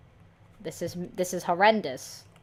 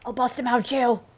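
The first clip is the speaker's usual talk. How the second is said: higher and louder, a rallying boast; mock heroic vow; 7 semitones higher, 9 dB louder, faster than usual. Fan vowing to free Kazuha from jail, loud and heroic. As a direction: declare it like a rescue hero.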